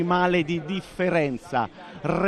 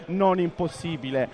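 ordinary talk

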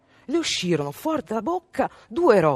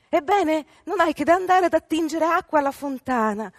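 mother's voice; 10 semitones higher, 5 dB louder than usual. A narrator's reading. Mother urging her favourite daughter.

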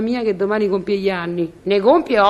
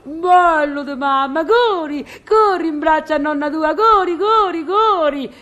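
imitating a calling grandmother, drawn out and pitched up; higher, stretched mock cries of encouragement; mock shrieking; 4 semitones higher than usual. Concierge mimics grandmother calling the toddler to run, mock shrieking. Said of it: "si è messa a strillò".

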